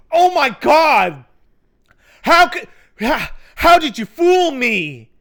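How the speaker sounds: hammed-up betrayal, cried out at full pitch; mock anguished outcry. Sam reacting to the twist, overblown mock anguish. Mimics Sam's ten-minute scene of disbelief.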